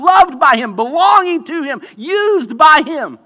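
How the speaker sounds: loud, high, impassioned proclamation; full-force preaching. Real, not acted. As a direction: ring out each promise at the top of your voice.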